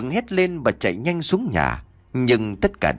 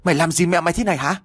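fast, higher-pitched angry demand; angry scolding shout; 7 semitones higher, much faster than usual. A narrator's reading. Father accuses Long over his mother, mildly shouted scolding. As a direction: fire out the accusation in a mild shout. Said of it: "miệng quát lớn".

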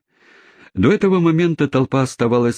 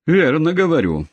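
insisting; 9 semitones higher than usual. Acted. Cabman insists to the crowd.

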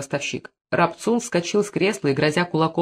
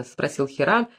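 ordinary delivery

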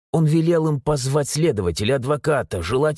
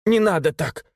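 sobbing plea; 5 semitones higher than usual. A narrator's reading. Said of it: "всхлипнула мама".

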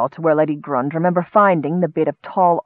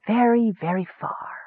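whispering; 3 semitones higher, 6 dB quieter than usual. A narrator's reading. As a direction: softly suggest a great distance. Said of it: "the voice whispered".

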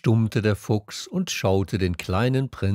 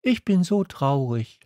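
put-on higher, childlike story voice; little prince; 7 semitones higher than usual. A narrator's reading. Little prince confiding in the fox, higher voice. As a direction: voice a small prince, pitched up.

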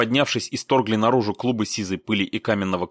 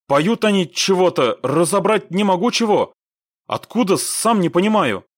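high, raised, bewildered voice; baffled report; 10 semitones higher, 4 dB louder than usual. A narrator's reading. Squad leader radioing about strange singing, baffled. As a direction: call it in, at a loss.

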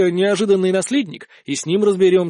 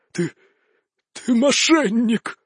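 slow, higher, halting croak; dying wheeze; 4 semitones higher, slower than usual. A narrator's reading. Shot count falling to his knees, wheezing accusation. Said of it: "прохрипел он, падая на колени".

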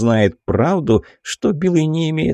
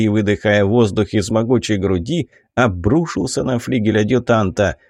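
ordinary delivery

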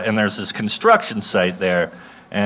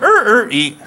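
mock strain noise; 12 semitones higher, 4 dB louder than usual. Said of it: "I just went".

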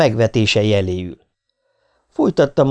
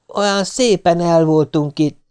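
higher, slow wistful complaint; sighing lament; 6 semitones higher than usual. A narrator's reading. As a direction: lament it slowly, pitched up. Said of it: "a harsány sóhajtozást".

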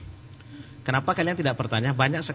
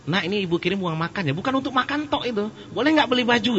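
mother's voice; 10 semitones higher than usual. Retold or imitated. Mother sending food money.

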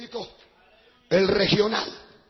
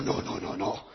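soft, low string of refusals; 8 dB quieter, slower than usual. Retold or imitated. Pastor refuses the demon, low and quiet. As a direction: drop the voice right down, refusing.

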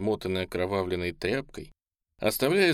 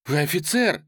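higher, agitated accusation; breathless agitation; 7 semitones higher, 4 dB louder than usual. A narrator's reading. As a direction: gasp out a reproach. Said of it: "задыхаясь, говорила Оля".

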